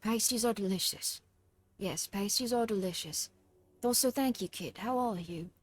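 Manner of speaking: put-on very soft, upset British child; small British-accented child's voice, kept gentle. Showing a friend her favourite voice, soft British child. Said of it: "I like to do this one".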